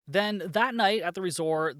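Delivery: ordinary talk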